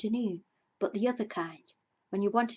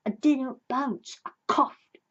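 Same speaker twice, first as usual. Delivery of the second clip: crossly; 4 semitones higher, 5 dB louder, slower than usual. A narrator's reading. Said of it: "said Tigger crossly".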